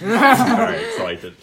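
giddy, drawn-out excitement; excited. Acted. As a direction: gush with nerdy excitement. GM voicing excited ginger nerd.

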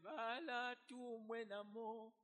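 slow plea in another tongue; plea in another language. Acted. Moses turning to God, slow pleading words. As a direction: plead it slowly in his own language. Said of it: "he now turns to God and says".

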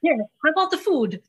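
high, fast, eager host offering food. Retold or imitated. Host imagines comforting Belle with food, eagerly. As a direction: push the food on her cheerfully.

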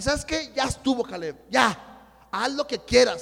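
loud, fed-up dismissal; retold exasperation. Giving up on arguing with his brother, loud exasperation. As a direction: cut the argument off, re-enacted. Acts out ending the quarrel.

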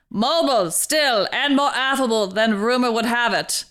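put-on old newsreel narrator; old-timey voice. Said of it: "an old-timey voice".

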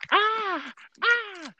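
very high mock groaning; hammed-up prank caller moan. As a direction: moan high like faking pain. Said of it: "my man was like".

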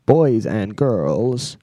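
slow, drawn-out American emcee voice; American accent announcer. Pretend. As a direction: stretch each word, American showman style. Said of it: "doing my American accent for the start".

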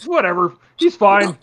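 mock careless dismissal; dismissive shrug. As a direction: wave it away like nobody cares. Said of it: "everyone just, like, gives up, like".

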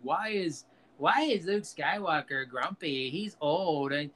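lightly imitated fan whine; mock complaining fans. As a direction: mimic petulant, indignant fans.

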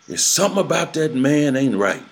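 high, hushed New Orleans Creole warning; Creole accent. Retold or imitated. Old mother warns Junior about his mentor, high Creole accent. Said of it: "you know, that Creole accent".

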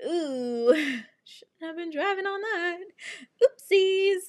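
high, squeaky mock guilt; sheepish oops. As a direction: wince through it in a tiny high voice. Finds she drove on flat.

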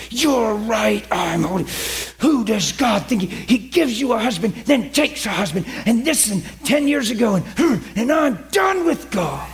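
fly off the handle, pitch swinging up; mock angry outburst. Wife venting at God to the preacher, acted outburst. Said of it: "She just flew out of her".

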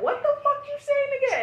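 high incredulous challenge; defiant retort. Retold or imitated. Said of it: "I'd be like".

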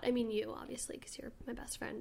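unvoiced whispered aside; whispering. Real, not acted. Aside to best friend.

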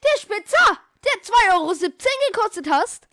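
high, loud, exclaimed reminder; loud accusing insistence. Real, not acted. Friend answering which sharpener was stolen, loud accusing insistence. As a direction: hammer it home with indignation.

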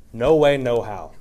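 NO WAY, NO HOW slow and loud; firm, drawn-out stress on "no way, no how"; emphatic no. Shown as a demonstration. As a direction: hit "no way, no how" hard and slowly. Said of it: "is an emphatic no".

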